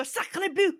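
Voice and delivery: high, loud comic exclamation; exaggerated French oath; mock French dismay. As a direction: cry out in hammed-up French horror. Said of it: "if you mess it up, you go".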